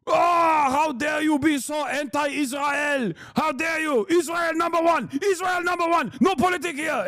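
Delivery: put-on high-pitched shouty tantrum in a thick accent; mock outraged Zionist. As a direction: ham up indignant yelling. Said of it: "getting mad at Miss Rachel and being like".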